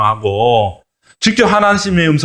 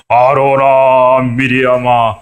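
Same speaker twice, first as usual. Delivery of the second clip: very slow, louder divine summons; God summoning Aaron and Miriam to rebuke them, slow and louder; stern voice of God; 4 dB louder, much slower than usual. Acted. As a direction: call them out slowly and forcefully as an angry God. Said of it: "막 야단을 치기 시작을 하는 거예요".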